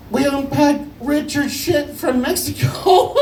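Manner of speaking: high, slow squeal collapsing into wheezy laughter; helpless laughing. Retold or imitated. Friend telling the mom about the package, squealing with laughter. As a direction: imitate a friend cracking up as he speaks. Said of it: "he can't laugh 'cause he can't".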